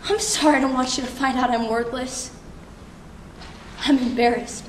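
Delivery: deflated, ashamed apology. Acted. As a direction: let the apology drop into quiet shame. Laughter collapses into shame.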